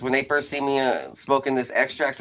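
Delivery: normal talking voice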